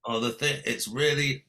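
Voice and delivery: ordinary delivery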